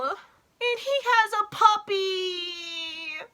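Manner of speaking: high, slow, teary; breaking up with tears; crying. Real, not acted. Fan gushing about the puppy, teary.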